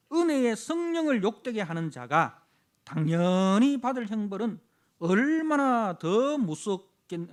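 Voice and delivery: slow, loud, weighty recitation of judgment; the preacher reading Hebrews aloud to the church; solemn scripture reading. Acted. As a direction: read it as a grave warning.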